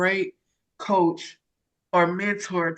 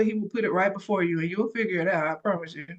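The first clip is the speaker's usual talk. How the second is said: usual speaking voice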